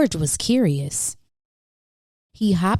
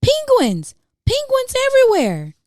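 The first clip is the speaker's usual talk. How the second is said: much higher and louder panicked exclaiming; alarmed cry; 4 dB louder than usual. Acted. Staff cry out as penguins run loose, high and loud.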